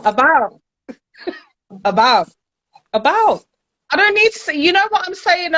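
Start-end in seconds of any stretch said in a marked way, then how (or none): 0.0-3.4 about said with the T dropped; defiant dropped-T about; about without the T. Shown as a demonstration. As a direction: clip the T off about. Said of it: "I don't need to say the T".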